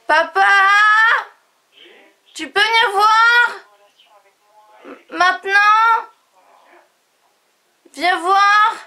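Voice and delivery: high, loud call to another room; yelling across the room. Real, not acted. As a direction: shout for dad to come over. Calling her dad from another room.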